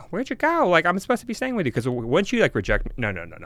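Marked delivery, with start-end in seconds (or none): 0.1-1.7 high, whiny pleading; hammed-up needy voice; mock needy texter. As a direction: play the clingy one she refuses to be. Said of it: "that guy texting him".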